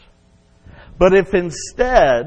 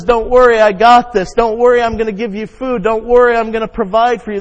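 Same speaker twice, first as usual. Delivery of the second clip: God's voice; 6 semitones higher, 7 dB louder, faster than usual. Acted. Preacher voicing God's reassurance.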